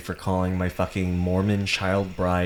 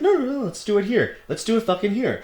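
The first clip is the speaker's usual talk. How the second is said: high, fast defiant taunt; high, quick dare to a bigger man; 13 semitones higher, faster than usual. Retold or imitated. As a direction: egg him on. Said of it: "I was like".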